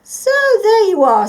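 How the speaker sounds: a fond grandpa just woken; waking grandpa. Acted. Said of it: "said Grandpa, waking up".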